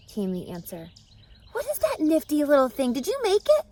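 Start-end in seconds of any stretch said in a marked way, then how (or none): 1.5-3.6 high, loud excited pig voice; eager young pig, pitched up; Wilbur the pig. A narrator's reading.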